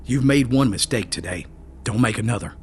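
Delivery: plain reading voice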